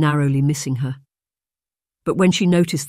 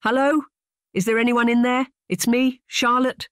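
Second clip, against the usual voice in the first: much higher, carrying call into the cave; 9 semitones higher than usual. A narrator's reading. Charlotte calls into the cave, high and carrying. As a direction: call out to find who is inside. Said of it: "she called out".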